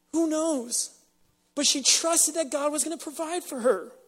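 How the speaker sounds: ordinary talk, nothing marked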